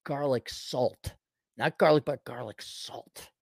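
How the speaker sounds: ordinary delivery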